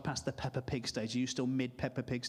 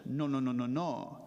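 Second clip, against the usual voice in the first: put-on French accent, slowing to a drawl; deliberate French-accented football manager; measured French accent, stretching the final phrases; Arsene Wenger; 2 semitones lower, slower than usual. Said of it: "that's my French accent".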